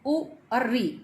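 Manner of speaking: ordinary delivery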